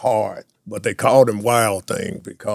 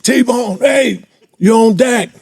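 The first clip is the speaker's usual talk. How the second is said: high, loud call down the tunnel; 12 semitones higher, 7 dB louder, slower than usual. Retold or imitated. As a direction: shout to a teammate below the stands. Teammates calling him out.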